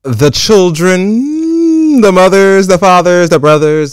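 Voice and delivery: loud, high, drawn-out hype chant; dramatic roll call. Acted. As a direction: belt each word like a big announcement.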